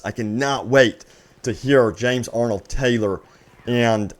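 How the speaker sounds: ordinary talk, nothing marked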